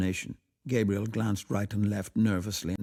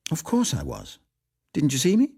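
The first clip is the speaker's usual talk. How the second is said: cheerful wife; 9 semitones higher, 6 dB louder than usual. Acted. Wife voiced, bright reply.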